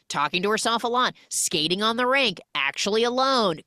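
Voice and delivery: normal talking voice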